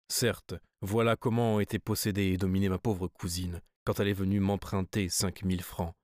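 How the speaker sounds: ordinary delivery, nothing marked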